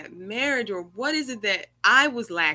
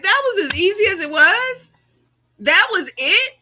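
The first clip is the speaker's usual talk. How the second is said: excited revelation; 8 semitones higher, 8 dB louder than usual. Retold or imitated. Sudden revelation retold with glee.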